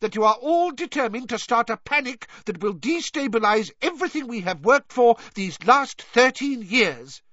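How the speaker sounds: blustering, outraged official; angry. A narrator's reading. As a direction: rage at them, slowing on the final words. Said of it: "now matching her anger".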